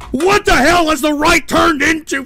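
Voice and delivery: high, loud indignant shout; exasperated outburst. Real, not acted. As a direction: demand it in outrage. Outburst at his own side.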